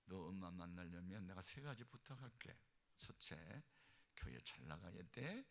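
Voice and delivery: low, soft, measured voice; gentle last requests. Retold or imitated. Dying mother giving her son last requests, gently. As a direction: ask softly, one request at a time.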